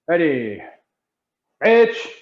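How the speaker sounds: sharp martial arts commands. Acted. Instructor commanding the class.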